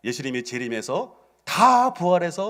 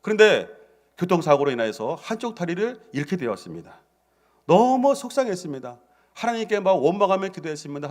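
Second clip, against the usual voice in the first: ordinary talk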